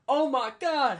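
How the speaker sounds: high, loud mimicked cry; acted exclamation. Player reacting to his long run, loud high acted outburst.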